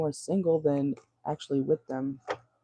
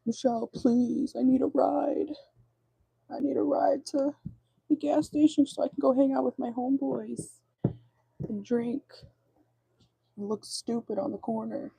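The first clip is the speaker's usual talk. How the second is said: whiny ex; 10 semitones higher than usual. Retold or imitated. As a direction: plead like a useless ex needing a ride. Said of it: "he's gonna be like".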